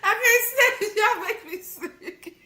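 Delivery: high, squeaky, laughing through the words; crying with laughter. Real, not acted. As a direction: barely get it out for laughing. Laughing to tears.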